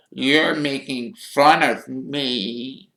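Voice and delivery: slow, high, wounded whine; tearful Myrtle. A narrator's reading. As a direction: accuse slowly, close to tears. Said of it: "silver tears welling rapidly".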